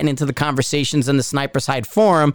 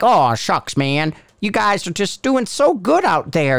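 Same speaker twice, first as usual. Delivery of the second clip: higher-pitched, mock-gushing praise; aw-shucks flattery; 5 semitones higher than usual. Ranter pretending to praise you guys, mock aw-shucks positivity. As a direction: lay on the folksy compliments, hammed-up. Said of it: "I need to learn some aw shuckins".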